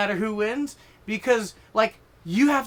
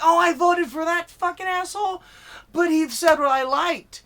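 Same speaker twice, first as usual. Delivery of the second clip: high, raised voice of a shocked voter; dismayed voter outburst; 9 semitones higher, 4 dB louder than usual. Acted. Voter seeing who the list belonged to, loud dismay. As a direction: blurt it out as a voter in mock dismay.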